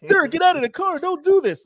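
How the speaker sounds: high, urgent cop voice; mock alarmed policeman; pleading officer. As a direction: beg him to stop, urgently.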